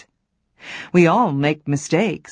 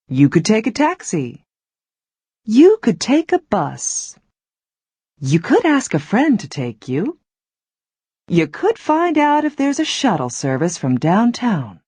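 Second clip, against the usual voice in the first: light suggestions; 4 semitones higher than usual. Shown as a demonstration. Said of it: "just want to suggest some possibilities".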